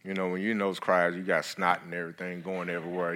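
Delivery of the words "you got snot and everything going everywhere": ordinary talk, nothing marked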